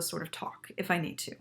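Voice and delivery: soft unvoiced whisper. Shown as a demonstration. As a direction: whisper it to save your voice. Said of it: "I might whisper".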